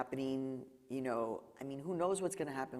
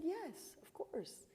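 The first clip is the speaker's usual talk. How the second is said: soft higher yes; 6 dB quieter, much slower than usual. Retold or imitated. She accepts the hug.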